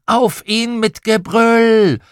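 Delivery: high, drawn-out charging yell; children's playful war whoop; battle cry. A narrator's reading. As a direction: roar into the attack, stretching the last word. Children's battle cry, leaping on father.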